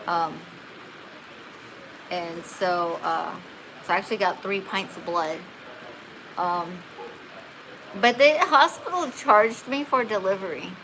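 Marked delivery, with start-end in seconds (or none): none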